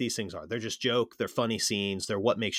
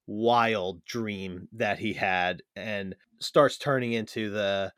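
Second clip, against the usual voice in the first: ordinary delivery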